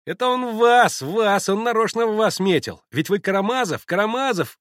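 put-on high, loud boyish yelling; boys' mocking shouts. A narrator's reading. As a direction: shout it as jeering boys. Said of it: "закричали хохоча мальчики". (насмешливые крики мальчишек)

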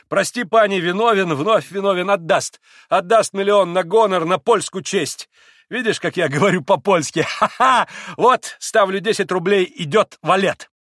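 loud, high, exuberant gambler; boisterous Mitya. A narrator's reading. Mitya apologising and staking roubles, loudly boisterous. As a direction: blurt it all out at full volume.

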